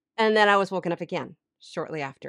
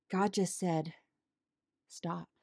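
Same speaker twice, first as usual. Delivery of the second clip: much softer, dropped voice; hushed; 2 semitones lower, 11 dB quieter, slower than usual. Retold or imitated. Woman relaying God's answer to her striving, hushed. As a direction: let it fall almost to nothing.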